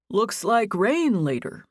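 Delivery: put-on unhurried older man; a lightly voiced slow father; slow dad voice. A narrator's reading. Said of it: "Henry's dad said".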